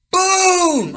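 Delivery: very high, loud mock explosion; loud boom. Describing the bomb going off in his face, loud boom. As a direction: shout the blast out. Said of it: "this thing blows up like you would not believe".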